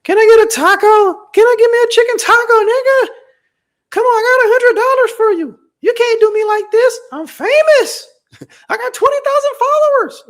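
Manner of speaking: very high, loud squeaky mock voice; mocking impression. Mocking a famous streamer begging the taco man, squeaky high and loud. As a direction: ham up a spoiled girl begging for a taco. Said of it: "'Cause he was like".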